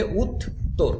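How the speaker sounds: "উত্তর" said as two separated syllables, উত and তর; syllable split. Shown as a demonstration. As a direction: pause inside "উত্তর" after উত. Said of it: "syllable ভাগ করলে".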